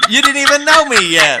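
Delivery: shouted teasing disbelief; mock outraged shout. Real, not acted. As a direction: yell it in fake outrage. Repeats protest over her denial.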